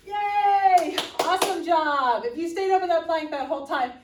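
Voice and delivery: loud, high celebration; excited cheering. Real, not acted. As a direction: yell the praise out. Coach celebrating end of plank.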